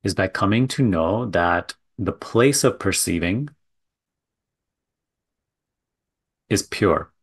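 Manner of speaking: measured guiding delivery with long silences between phrases; contemplative spiritual teaching. Real, not acted. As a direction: let each phrase land, then leave a long pause. Leads a contemplative talk.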